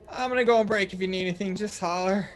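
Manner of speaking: high gnome voice calling up from below; winded gnome barmaid. Acted. Gnome barmaid going on break after climbing, high winded voice. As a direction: pipe up high, acting worn out from the climb. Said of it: "just out of breath, just huffing and puffing".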